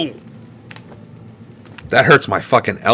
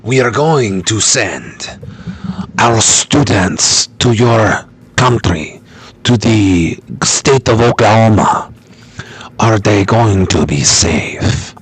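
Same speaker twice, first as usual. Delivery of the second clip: put-on slow, measured telenovela Mexican accent; unhurried mock telenovela Latin accent; 3 semitones lower, slower than usual. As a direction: ask slowly in a dramatic Mexican accent. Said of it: "trying to do my telenovela".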